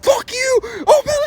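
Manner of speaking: high-pitched hammed-up furious yell; yelling grandpa. Grandpa at his limit to toddler, mock furious yelling. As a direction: lose it completely, mock screaming. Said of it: "my grandpa is yelling at her".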